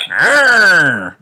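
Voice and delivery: loud, high little roar; dinosaur roar. A narrator's reading. George being a dinosaur, roaring.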